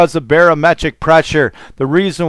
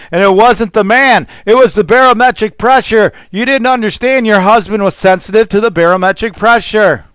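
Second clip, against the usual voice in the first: raised, heated pulpit preaching pitched higher; preaching at full force; 4 semitones higher than usual. Acted. Preacher rails at wives over talk shows, full-force preaching. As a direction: drive it home to the congregation.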